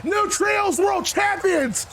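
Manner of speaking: high, drawn-out victory shout; triumphant announcer call. Real, not acted. Gamer announcing the new Trios champions, triumphant shout. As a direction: crown the champions like a ring announcer.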